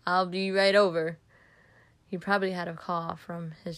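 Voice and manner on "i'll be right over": raised voice, louder than the narration; yelling. A narrator's reading. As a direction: call it out loudly into the phone. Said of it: "I hear my dad yelling out on the phone".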